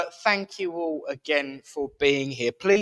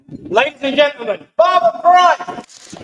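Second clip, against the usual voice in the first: announcer; 11 semitones higher, 11 dB louder than usual. Real, not acted. Host announcing the medium to the room, grand announcer voice. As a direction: introduce the star like a compere.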